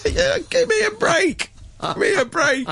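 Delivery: very high, squeaky laughing protest; laughing exasperation. Real, not acted. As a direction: squeal it through helpless laughter. Laughing exasperation after mocking bureaucrats.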